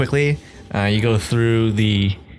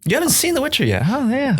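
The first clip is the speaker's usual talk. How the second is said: teasing. Real, not acted. Teasing friend about show.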